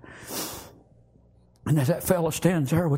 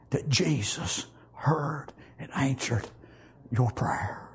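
soft whisper, slowing; hushed reverence; 2 semitones lower, 4 dB quieter, slower than usual. Acted. Jesus telling the son his prayer was heard, whispered. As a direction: breathe the answer out gently.

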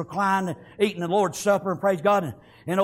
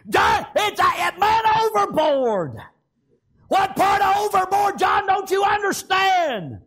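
high, drawn-out shout at John; panicked exasperation; 12 semitones higher, 4 dB louder, slower than usual. Acted. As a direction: yell as if he is not getting it. Exasperated crew yelling at John.

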